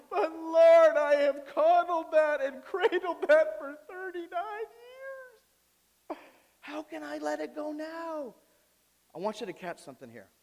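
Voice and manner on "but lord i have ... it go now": very high mock-tearful wail, loud then easing; hammed-up sobbing plea to God, words dragged out; whining believer. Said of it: "that's how our response is".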